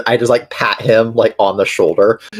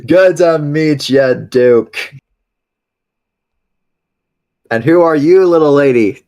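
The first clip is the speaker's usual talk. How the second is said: higher, hearty friendly voice; jovial greeter; 6 semitones higher than usual. Acted. Amon kneeling to greet the tiny fairy, hearty and warm. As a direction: greet them like a big friendly uncle.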